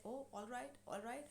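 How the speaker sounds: soft retold backing down; mildly intimidated. Threatened husband yielding to his wife, mildly intimidated. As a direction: give in quietly, as if threatened. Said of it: "now he is intimidated".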